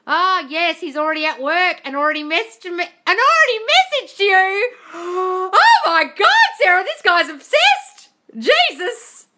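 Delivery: high, loud, stretched excitement; high and loud, almost shrieking with delight; thrilled squealing. Real, not acted. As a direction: gush over the juicy news. Thrilled by viewer's SP news.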